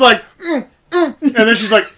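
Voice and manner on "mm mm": high-pitched closed-mouth protest noises; mock resisting moan. Imitating Pussy Galore being kissed in the barn, high muffled protest. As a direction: imitate muffled squeals against a kiss.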